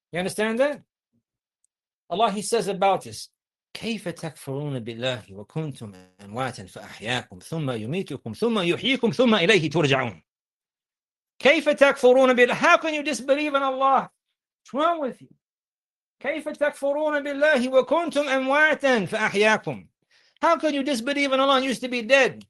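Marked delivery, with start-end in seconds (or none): 3.7-10.2 measured Arabic recitation, starting low and building; low-pitched scripture recitation that gradually lifts; Quran recitation. Real, not acted. 11.4-22.4 high, forceful preaching; incredulous preacher pressing the verse home; impassioned rebuke. Real, not acted.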